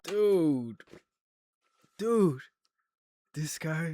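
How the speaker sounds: loud, high exasperated "dude"; exasperated shock. Real, not acted. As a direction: blurt it in disbelief. Shocked reaction to flat compliment.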